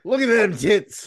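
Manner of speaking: high, loud ogling shout; mock leering holler. [name] ogling ring girls on screen, high mock holler. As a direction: whoop it at the screen.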